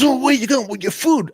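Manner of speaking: high, loud restaurant owner yell; mock outraged shout; retold angry challenge. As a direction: imitate an owner yelling after a customer. Owner challenges departing customer, retold.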